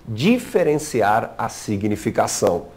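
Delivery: ordinary talk, nothing marked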